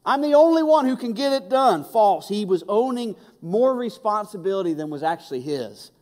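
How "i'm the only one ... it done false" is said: loud, high preaching build; preaching at full force. Acted. Preacher stirring his congregation, loud and high. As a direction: drive it home from the pulpit.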